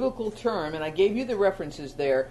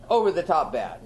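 normal talking voice

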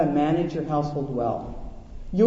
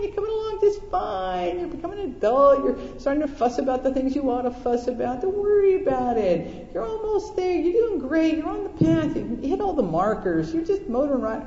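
tender encouragement; 11 semitones higher than usual. Retold or imitated. Father reassuring his grown daughter, high and tender. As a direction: coo it like comforting a child.

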